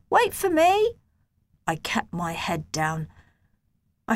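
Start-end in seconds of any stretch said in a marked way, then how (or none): 0.1-1.0 high boyish call, voiced apart; calling friend. A narrator's reading. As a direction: call after him so he waits. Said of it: "he shouted".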